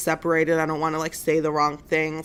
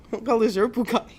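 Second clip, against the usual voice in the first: laughing; 3 semitones higher, much faster than usual. Real, not acted. Joking aside about fancy cars.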